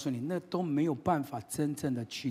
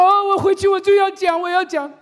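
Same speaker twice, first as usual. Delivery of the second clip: high, loud, overeager voice; shouted, pitched-up parody of zeal; mock eager zealot; 13 dB louder than usual. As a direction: play the gung-ho listener. Mocking eager listener.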